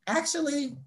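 lilting, self-satisfied reply; pleased comeback. Retold or imitated. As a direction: relish turning them down. Savoring turning down the job.

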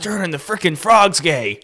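Alex Jones. Acted. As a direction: imitate a host raving in outrage, slowly stretched. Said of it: "Alex Jones one where he just goes".

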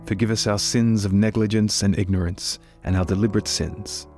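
ordinary talk

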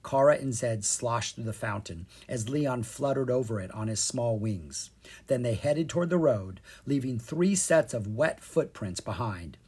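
ordinary delivery

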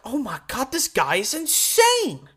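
high, slow gush of amazement; mock disbelief at a wild play; awed exclamation. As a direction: lean back stunned and let it rise high. Retelling awe at Richardson's plays.

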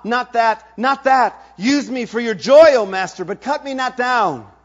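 high, loud, pleading cry of horror; horrified pleading. Acted. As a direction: beg the master not to cut you down.

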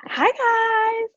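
cracked greeting, voice jumping up; voice cracking registers. Real, not acted. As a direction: voice breaking high on the greeting. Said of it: "Oh my God, my voice".

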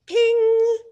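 high, loud sustained ping; phone ping. Retold or imitated. Host mimics her phone as the offer arrives, high ringing ping. Imitates phone notification sound.

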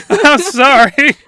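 words squeezed through laughter; laughing apology. Real, not acted. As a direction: break up laughing while apologizing. Cracks up at own identical voices.

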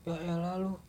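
a hint of mock exasperation; exasperated. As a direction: tease a crying friend, half annoyed. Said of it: "Gua gitu kan".

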